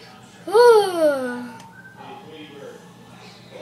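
loud, long hammed-up sigh; exasperated sigh. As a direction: heave a big mock-exasperated sigh. Teacher exasperated by class.

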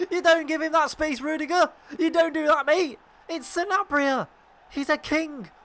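high, loud taunting yell; gloating shout. Real, not acted. Gamer mocking the rival defender Rudiger, loud gloating yell. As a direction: crow over the beaten defender.

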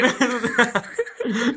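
quick soft chuckle; soft laugh. Real, not acted. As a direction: laugh under breath. Laughing during game.

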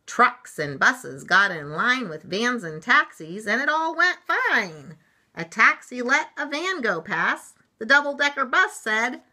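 loud, animated picture-book rhyme; story read-aloud. A narrator's reading. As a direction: build the uproar for young listeners.

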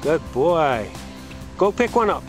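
normal talking voice

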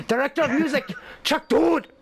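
mock-grand Japanese-accented name announcement; horrible Japanese accent. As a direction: proclaim it in a fake Japanese accent. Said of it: "doing a horrible Japanese accent".

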